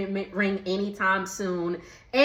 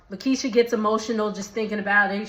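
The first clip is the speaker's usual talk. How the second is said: ordinary delivery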